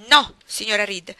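lento, forte e deciso; rifiuto secco. A narrator's reading. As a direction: scandisci il no con freddezza. Jane replies coldly. (Jane respinge la zia, no lento e fermo)

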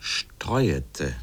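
"Streuete" in three syllables, Streu-e-te, the E clearly sounded; "Streuete", drawn out with its full middle E; extra E sounded. Shown as a demonstration. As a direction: stretch "Streuete" slowly so the extra E is heard, not "streute". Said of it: "nicht streute".

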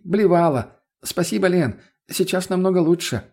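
high voice calling from another room. A narrator's reading. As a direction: call back thanks through the door. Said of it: "донёсся глухой крик". (выкрикивать из другой комнаты высоким голосом)

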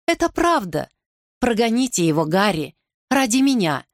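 high, insistent female plea, easing down; urgent pleading. Acted. Anne begs Harry to banish Suffolk, pitched high and pleading. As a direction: start high and beg him emotionally.

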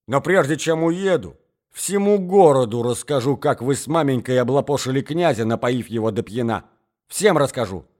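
loud threats from a furious man; enraged shouting. Acted. Jilted suitor threatens to expose mother and daughter, enraged. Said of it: "кричал он".

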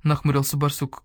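ordinary delivery

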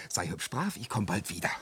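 soft, low parting words; low and soothing, almost to oneself; tender goodbye. Acted. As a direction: reassure her gently before leaving.

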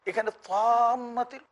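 তাম্মাতিল said high and slow with heavy ত. Shown as a demonstration. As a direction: stress the thick ত of তাম্মাতিল. (মোটা ত দিয়ে তাম্মাতিল)

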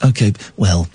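low, lightly posh imitation of Epstein; posh Epstein impression. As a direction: mimic the posh manager. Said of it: "you're gonna go".

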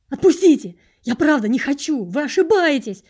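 high, very loud desperate plea; frantic shouted protest; screaming at full strength. A narrator's reading. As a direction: yell for your life. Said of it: "изо всех сил закричал я".

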